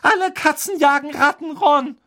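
high-pitched agitated protest; upset defence. A narrator's reading. Hermine verteidigt im Streit ihren Kater. (aufgeregt rechtfertigend)

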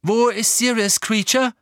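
high, loud demanding question; urgent demand. A narrator's reading. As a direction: demand an answer from a servant.

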